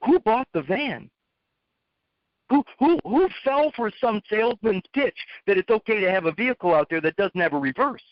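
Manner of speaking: lightly acted exasperation; mock lecture. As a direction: retell grilling the kid. Lecturing kid about his van.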